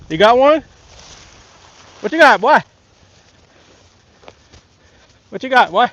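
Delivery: loud, high calls across the water; hollering. Real, not acted. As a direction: holler to your dad who can't hear.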